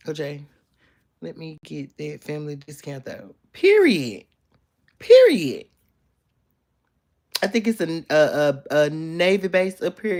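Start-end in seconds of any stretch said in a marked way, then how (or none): 3.5-5.7 sassy emphasis. Acted. Emphatic sign-off after a joke.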